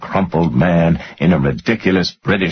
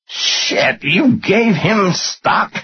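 slow, high-pitched aghast question; incredulous; much slower than usual. A narrator's reading. Finance guy learning his boss gave away stock, incredulous. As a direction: draw it out in disbelief, rising high.

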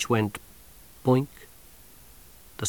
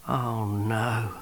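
soft, hushed dread; whispered; 8 dB quieter than usual. Acted. Sergeant spotting the old woman, hushed. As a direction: breathe it out in dismay. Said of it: "he whispered".

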